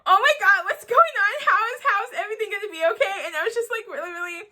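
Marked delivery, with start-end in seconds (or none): 0.0-3.2 high, loud, tearful panic; panicked crying. Retold or imitated. Reader reliving the shock of the vault scene, tearful panic. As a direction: wail it like the twist just broke you. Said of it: "I'm like".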